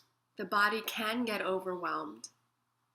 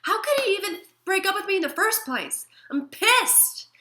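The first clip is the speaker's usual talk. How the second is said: much louder, much higher, outraged rant; furious disbelief; indignant anger; 11 semitones higher, 12 dB louder than usual. Shown as a demonstration. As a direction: vent at the ex who broke up with you. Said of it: "then be angry, like".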